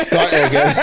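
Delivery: laughing high while speaking; cracking up mid-sentence. Real, not acted. Restarts, cracking up.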